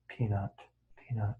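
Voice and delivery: soft, low, fading repetition; inward repetition. Shown as a demonstration. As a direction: repeat it quietly as if inside your head. Models saying a word in the mind.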